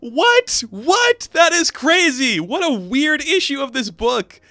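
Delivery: very high, loud squeal of disbelief; real giddy bafflement, easing down; amazed disbelief. Real, not acted. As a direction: exclaim as if your mind is blown. Reviewer astounded after laughing.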